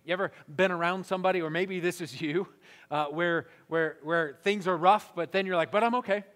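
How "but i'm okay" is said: higher, louder mock-okay deflection; breezy reassurance. Person dodging rough times, breezy and insistent. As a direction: pop out of the gloom, insisting all is fine. Acts out brushing off hard times.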